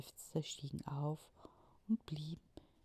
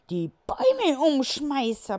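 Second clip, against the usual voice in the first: high, loud chirping bird voice; peeping birds; 11 semitones higher, 15 dB louder than usual. Acted. Birds crying out to the other animals, high chirp. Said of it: "piepsten die Vögel".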